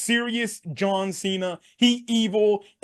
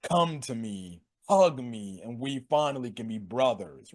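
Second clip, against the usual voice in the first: low, soft, creepy seduction; perverse, sexual coaxing; 9 semitones lower, 6 dB quieter than usual. Retold or imitated. Wrestler tempting his rival to join him, perversely seductive. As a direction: imitate a lewd invitation, dropped low and quiet. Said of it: "sexual, like, perverse fucking way".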